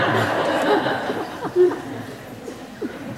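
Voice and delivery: breathy high burst of laughter; real laugh. Real, not acted. Laughs at own story.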